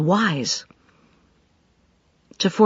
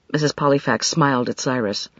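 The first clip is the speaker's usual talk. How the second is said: plain reading voice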